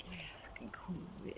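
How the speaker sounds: emphatic hushed whisper. Real, not acted. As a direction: whisper it like a secret.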